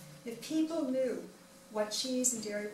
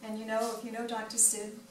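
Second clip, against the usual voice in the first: ordinary delivery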